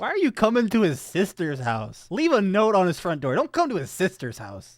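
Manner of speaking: loud, high protest at an intrusion; indignant scolding. Real, not acted. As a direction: scold the character on screen. Scolding a character on screen.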